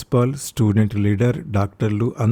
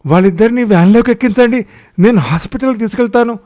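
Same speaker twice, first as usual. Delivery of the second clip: high, loud, fast commanding yell; loud shout; 10 semitones higher, 7 dB louder, faster than usual. A narrator's reading. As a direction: bellow orders at the crowd. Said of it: "గట్టిగా అరిచాడు సత్యం".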